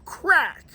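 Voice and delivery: high, sharp crack of the bat; sharp sound-effect crack. A narrator's reading. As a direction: snap it out like a bat hitting. Bat hits the ball again.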